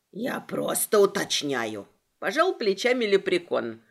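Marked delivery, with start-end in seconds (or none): 0.1-1.9 low sly leprechaun. Acted. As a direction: play the pedantic leprechaun low. Leprechaun voiced by narrator.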